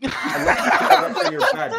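squealing tape taunt. Retold or imitated. Cursed tape taunting Arthur, high mock squeal. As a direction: screech it like a petty tormentor. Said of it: "One last man put in the tape".

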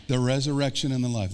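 ordinary talk, nothing marked

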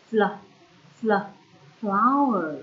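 fl, fl clipped short then flower said loud; sounding out the blend. Shown as a demonstration. Teacher to young pupils, sounding out fl before flower. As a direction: isolate fl twice, then land on flower. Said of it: "sounding out onsets".

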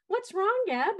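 put-on high, loud little-kid voice; imitating a young child. Young camper asking the director what's wrong in a high child voice. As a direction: ask it like a worried small camper. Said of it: "looked at me and said".

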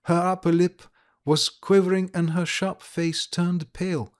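usual speaking voice

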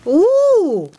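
loud, high swooping ooh; delighted ooh. Real, not acted. Delighted at next gift.

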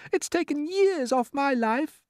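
high, slow falsetto; ladybird. A narrator's reading. Ladybird lamenting the trip, high slow complaint. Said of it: "the ladybird said".

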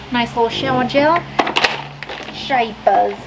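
light put-on Australian accent, lifting at the end; playful, rough Australian accent; attempted Australian accent. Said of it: "the accents, I know".

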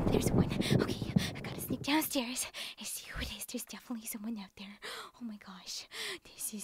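hushed whisper, sneaking downstairs. Acted. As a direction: whisper so the intruder can't hear. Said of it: "I have to be quiet".

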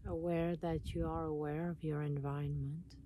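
calm meditation voice with long pauses; guided meditation. Acted. Guiding listeners to relax and notice their breath, meditation voice. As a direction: guide them inward, unhurried, leaving space between phrases.

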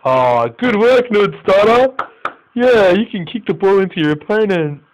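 high-pitched sarcastic cheering; mocking, exasperated congratulations; sarcastic praise. Real, not acted. Mocks own player's mistake.

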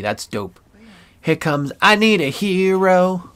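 ordinary talk, nothing marked

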